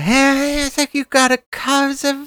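high whiny imitation of Jim Baker. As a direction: whine it up high. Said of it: "he has that whiny voice".

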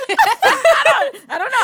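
high, loud protest through laughter; helpless laughing. Real, not acted. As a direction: crack up while denying it. Laughing at friend's teasing question.

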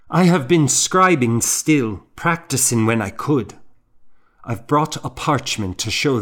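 put-on young scribe arguing to be let in, earnest and eager; eager insistent monk. A narrator's reading. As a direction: press your value on him, hopefully. Young monk argues his worth.

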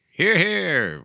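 a hint of a posh backbencher; mildly mocking imitation of MPs calling hear hear; mock British MPs. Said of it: "They say".

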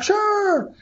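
very high, drawn-out yes; hammed-up gung-ho acceptance; eager agreement. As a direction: agree instantly, voice shooting up. Eagerly accepts offer in retold call.

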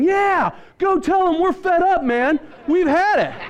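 high, drawn-out rowdy shout; imitated rallying passengers. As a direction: egg him on like an angry crowd. Acted fed-up coach passengers egging him on.

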